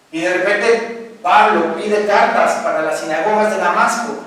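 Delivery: loud, fast, urgent preaching; loud, fast preacher retelling; dramatic sermon telling. Acted. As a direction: drive the story home.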